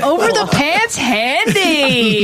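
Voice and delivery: high, drawn-out echo of a crude phrase; mock disbelief. Real, not acted. Host repeating the guest's crude phrase back, drawn-out amused disbelief.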